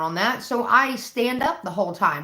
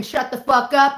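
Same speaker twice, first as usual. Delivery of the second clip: mock scream; 5 semitones higher, 5 dB louder than usual. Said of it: "three hillbillies screaming".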